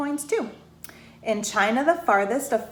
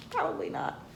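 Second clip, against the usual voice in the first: whispered aside; 8 dB quieter, faster than usual. Real, not acted. Host musing about piggy bank.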